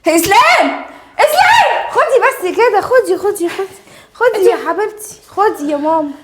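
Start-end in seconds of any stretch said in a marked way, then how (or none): 0.1-0.8 loud call across the house. Acted. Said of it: "انت بتزعقي ليه؟". 1.2-2.2 much higher, loud yell of his name; shrieking call. Acted. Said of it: "انت بتزعقي ليه؟".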